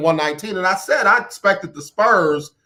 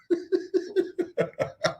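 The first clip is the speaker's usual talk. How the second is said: airy unvoiced high giggle; breathless laugh; 10 dB quieter than usual. Real, not acted. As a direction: wheeze out a laugh.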